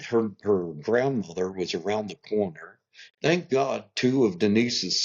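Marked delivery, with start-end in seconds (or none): none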